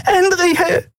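high, ringing call of her name. Acted. Nelen calls out to Endriel, high and urgent. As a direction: cry out to her across the room. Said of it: "hörte sie Nelen rufen".

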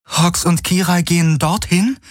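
high, unsure questioning; doubtful. A narrator's reading. Kirai doubts the spot Hawks points to, high and unsure. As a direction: ask as if you can't believe it. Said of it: "fragte sie zweifelnd".

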